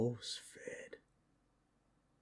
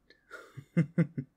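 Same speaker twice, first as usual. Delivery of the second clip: loud, higher scornful laugh; mocking chuckle; 7 semitones higher, 8 dB louder than usual. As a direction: scoff at the chains. Mocks being chained.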